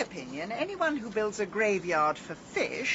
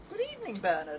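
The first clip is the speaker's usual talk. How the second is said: innocent greeting; 14 semitones higher, much faster than usual. Pretend. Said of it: "What are you going to say if Bernard comes back?".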